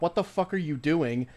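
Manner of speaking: ordinary delivery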